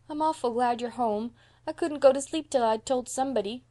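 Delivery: put-on high, upset little boy; lightly acted tearful confession; sobbing. A narrator's reading. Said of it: "sobbed Davy".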